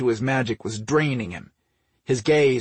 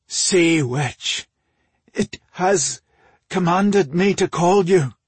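higher, halting, strained announcement; a touch of dread, voice raised; shuddering; 6 semitones higher, 5 dB louder, slower than usual. A narrator's reading. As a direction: force it out in pieces with a shudder. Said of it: "Ore said with a shudder".

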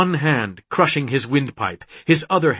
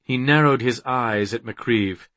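ordinary delivery, nothing marked

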